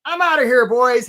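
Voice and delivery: breezy crab announcing he's leaving; crab quitting. Retold or imitated. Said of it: "one of the crabs is like".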